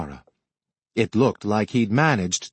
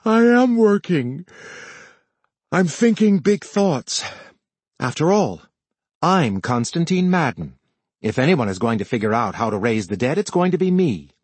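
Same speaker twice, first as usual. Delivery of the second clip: grandiose and pompous; 5 semitones higher than usual. A narrator's reading. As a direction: play the self-important genius to annoy. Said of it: "Cole yawned exaggeratedly".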